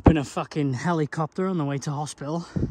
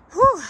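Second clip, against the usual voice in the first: breathless whew; 6 dB louder than usual. Real, not acted. Exhale after steep climb.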